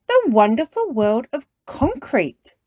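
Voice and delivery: reading a title aloud, high and loud; Mummy Pig. Acted. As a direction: read out a book title with a big, lifted voice. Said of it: "reads Mummy Pig".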